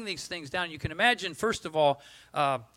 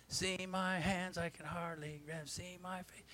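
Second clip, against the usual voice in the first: weak pleading leper; 12 dB quieter, slower than usual. Retold or imitated. Lepers voiced clamoring to Jesus.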